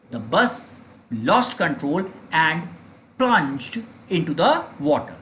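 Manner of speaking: very slow, broken into two chunks. Shown as a demonstration. Teacher repeats the sentence for students, very slow and chunked. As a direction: dictate it in short pieces.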